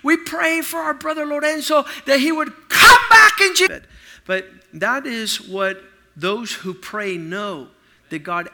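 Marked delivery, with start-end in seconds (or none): 0.0-3.8 loud, high, shouting prayer; hammed-up shouted prayer in Jesus' name; mock preacher prayer. Mocking a church member's loud shouted prayer. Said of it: "I don't want somebody".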